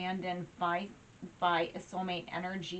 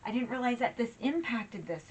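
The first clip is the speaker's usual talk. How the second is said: higher, amazed "whoa" from someone realising something; imitated surprise; 5 semitones higher, 4 dB louder than usual. Voicing the viewer discovering what they did, surprised. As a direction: act out a listener's sudden realisation. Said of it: "and you're like".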